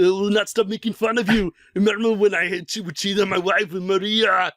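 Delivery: a hint of a stern action hero; Arnold Schwarzenegger. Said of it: "starts cueing the Arnold noises".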